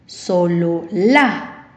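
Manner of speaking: "solo la" drawn out slowly, voice rising strongly on "la"; stress on last syllable. Shown as a demonstration. Teacher showing where the voice's force falls, slow stress on final "la". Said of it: "¿Escuchas la fuerza de voz?".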